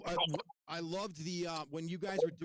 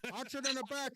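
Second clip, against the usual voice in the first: higher mock caller voice; quoting, higher; 7 semitones higher than usual. Fan quotes the caller's order in a higher mock voice. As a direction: pitch it up like the frantic caller. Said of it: "he said".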